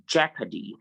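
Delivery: jeopardy as JEP-a-dee; short E and silent O in jeopardy; correct pronunciation. Shown as a demonstration. Teacher correcting students, jeopardy as JEP-a-dee. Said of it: "It's jeopardy".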